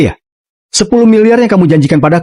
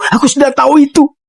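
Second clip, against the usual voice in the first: high-pitched burst of relief; excited exclaim; 10 semitones higher than usual. Acted. Mother-in-law repeating in relief.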